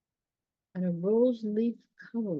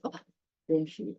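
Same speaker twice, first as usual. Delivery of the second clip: plain reading voice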